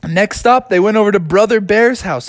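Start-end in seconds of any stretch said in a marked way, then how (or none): none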